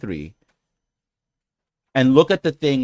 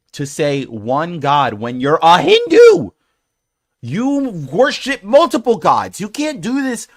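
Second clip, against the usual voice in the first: rising outraged rant; 7 semitones higher, 9 dB louder than usual. Real, not acted. Ranting at commenter, rising outrage.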